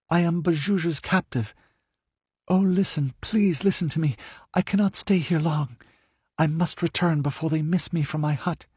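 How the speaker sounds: high, earnest urgent pleading; begging him to listen quickly; pleading captive. A narrator's reading. Livia's voice in narration.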